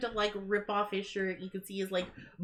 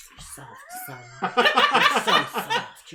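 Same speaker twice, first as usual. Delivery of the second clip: laughing mock tough dad; 7 dB louder than usual. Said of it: "you'd be like".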